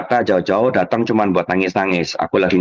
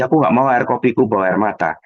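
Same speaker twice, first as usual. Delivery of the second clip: ordinary delivery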